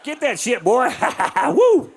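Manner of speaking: high-pitched, cackling hillbilly egging on. Acted. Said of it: "I'm the inbred redneck hotel owner".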